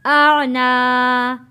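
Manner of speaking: very slow, with "ना" drawn out; stretched recitation. Shown as a demonstration. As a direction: draw both syllables out long. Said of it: "खींच कर पढ़ा है". ("आ ना" बहुत खींचकर)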